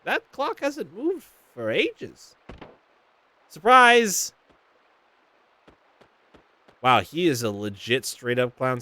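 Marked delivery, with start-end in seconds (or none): 0.0-2.4 lightly voiced villager, higher and slow; puzzled villager. Reads NPC dialogue line. 3.5-4.3 loud, higher playful shout; skeleton surprise. Acted. Skelly pops out.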